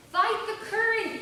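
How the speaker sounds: loud, high urgent shout. A narrator's reading. Rescuer yelling to the woman in the current. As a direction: shout the order as he dives in. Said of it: "he shouted".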